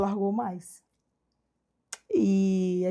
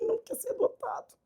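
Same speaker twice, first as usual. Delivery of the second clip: disbelief; 12 semitones higher, 10 dB quieter than usual. Retold or imitated. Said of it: "Não acredito".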